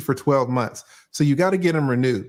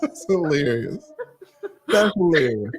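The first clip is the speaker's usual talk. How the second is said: talking through laughter; laughing. Real, not acted. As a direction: let the laugh carry the words. Still laughing after the punchline.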